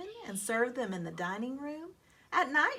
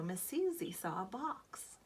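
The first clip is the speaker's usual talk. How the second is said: ordinary delivery, nothing marked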